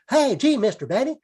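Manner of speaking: put-on bright-eyed young fellow calling out. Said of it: "who go".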